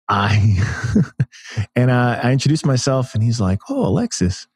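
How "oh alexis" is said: Mike Tyson. Acted. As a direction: badly imitate a famous boxer, pitched way up. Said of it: "that's my terrible Mike Tyson impression".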